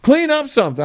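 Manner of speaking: put-on a dad telling the kid to get off his butt; fed-up parent rant. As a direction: nag the lazy kid hard. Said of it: "I mean, this is me".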